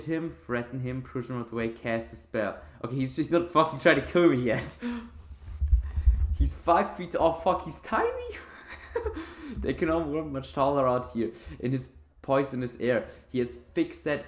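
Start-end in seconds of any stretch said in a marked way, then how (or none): 4.8-6.3 airy high burst of laughter; cracking up at the tiny villager; breathless laugh. Real, not acted. Tiny villager blocks the path. 8.4-9.5 squeaky high laugh trailing off; giggling at how short he is; high giggle. Real, not acted. Tiny villager blocks the path.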